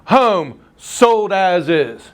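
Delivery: slow, deliberate "Home sold as is."; reading the listing. Shown as a demonstration. Instructor reading a listing remark to the class, slow and deliberate. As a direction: drag out each word of "Home sold as is.". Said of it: "in the general remarks".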